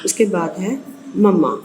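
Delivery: usual speaking voice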